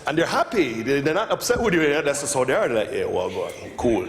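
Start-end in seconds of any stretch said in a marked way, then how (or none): 2.9-4.0 easy Jamaican shrug of a reply; low laid-back Jamaican. Retold or imitated. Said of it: "they're speaking low tones".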